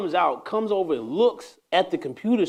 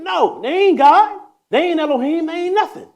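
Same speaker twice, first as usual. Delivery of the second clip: high, loud rising cries; preaching shout; 13 semitones higher, 8 dB louder than usual. Acted. Rejecting confession to priests, high preaching shouts. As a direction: shout it out at the peak.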